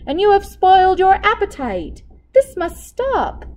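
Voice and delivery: high, loud scolding; stern cook. A narrator's reading. Cook scolds the king for filching candy, high and loud. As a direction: scold him like a child caught out.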